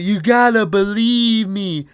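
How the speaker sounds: high, drawn-out mock plea; hammed-up desperate imitation; begging. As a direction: plead hard, stretching the words. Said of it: "where he's begging her".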